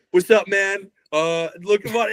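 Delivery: a hint of a chill bro greeting; casual dude. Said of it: "a dude that's like".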